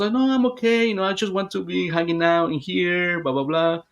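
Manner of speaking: put-on child's voice. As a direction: lightly play the contented child who wants to stay. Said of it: "She changed her voice for a, a child".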